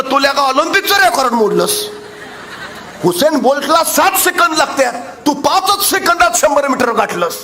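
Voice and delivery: high-pitched, mildly acted astonishment; amazed questioning. Speaker confronts boy who broke the Olympic record, amazed questioning.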